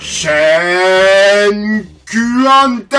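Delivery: stretched name. Acted. Hammed-up name announcement.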